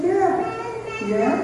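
high little yes; imitating wife. Wife accepting husband's vow renewal, imitated high voice.

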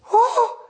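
high-pitched imitated cry of "oh"; dramatic "oh". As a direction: mimic her overwhelmed, high "oh". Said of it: "she's getting pretty dramatic".

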